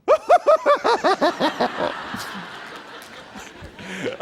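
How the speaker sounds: loud rising burst of laughter; big laugh. Real, not acted. Punchline of Hertz story.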